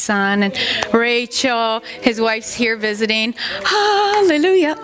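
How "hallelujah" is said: high and loud praise, a happy burst; joyful hallelujah. Real, not acted. Pastor praising God that his son and daughter-in-law are visiting, joyful and loud. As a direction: shout it out with joy.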